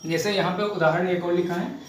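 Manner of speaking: normal talking voice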